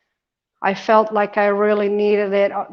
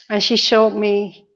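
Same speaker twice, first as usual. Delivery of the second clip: ordinary delivery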